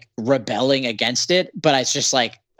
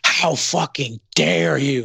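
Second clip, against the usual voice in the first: slow, higher offended whine; imitating an offended fan online; mock outraged fan; 4 semitones higher, much slower than usual. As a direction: drag it out like a scandalised fan. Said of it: "people would be like".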